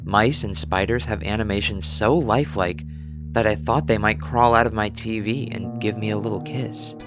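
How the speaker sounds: usual speaking voice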